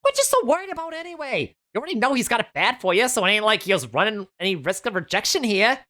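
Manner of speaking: high, fast New York stylist voice; New York accent. Acted. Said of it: "really thick New York accent".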